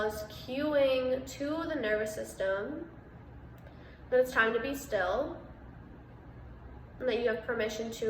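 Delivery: measured meditation voice with long pauses; guided meditation. Real, not acted. Meditation host guiding listeners through breath and body awareness, calm and spacious. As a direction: guide listeners into stillness, unhurried, pausing between phrases.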